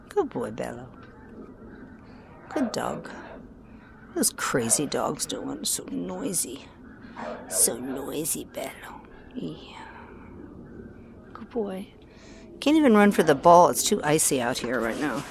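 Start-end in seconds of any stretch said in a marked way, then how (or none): 0.1-11.9 slow hushed whisper to the dog. Real, not acted. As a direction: murmur it softly, close to his ear. Whispering to dog about barking.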